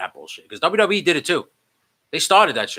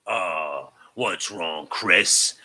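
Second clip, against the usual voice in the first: low, soft villain taunt; mock concern; 7 semitones lower, 3 dB quieter, much slower than usual. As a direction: feign sympathy in a lowered voice. Villain taunts helpless Chris.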